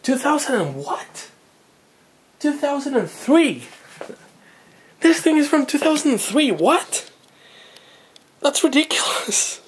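high-pitched, astonished outburst; incredulous, voice leaping up; real disbelief. Real, not acted. Baffled hobbyist discovering how new the old box is, astonished. Astonished at the box's manufacture year.

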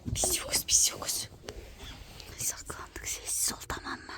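breathless hushed whisper; whispering while hiding. Pretend. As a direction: keep totally quiet while hiding. Hiding during hide and seek.

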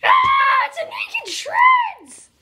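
high, loud cry of alarm; mock shriek. Plushie spotting naked Shreds, mock shriek. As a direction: yell it out high, drawing out the name.

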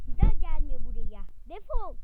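loud, spat-out get-out; angry shout. Acted. Friend shouting at the broke girl to get lost. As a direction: drive her off.

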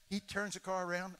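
ordinary delivery

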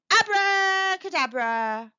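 a witch calling out her magic word; magic spell shout. Acted. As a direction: cast the spell with a grand flourish.

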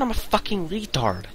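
normal talking voice

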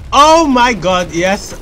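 loud, high, drawn-out exclamation; excited outburst. Real, not acted. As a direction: cry out slowly in amazement. Startled outburst during a firefight.

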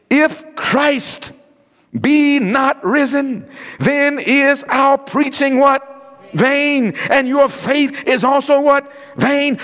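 high, slow, drawn-out scripture reading; preaching scripture. Real, not acted. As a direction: proclaim each word slowly on a high pitch. Emphatic scripture reading to congregation.

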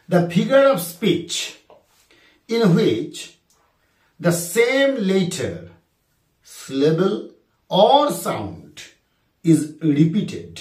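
drawn-out, word by word; slow dictation. Shown as a demonstration. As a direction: dictate the question slowly to students. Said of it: "আরেকবার বলি".

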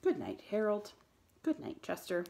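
calmer, milder good night. A narrator's reading. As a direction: settle down and say good night evenly. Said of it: "and then more calmly".

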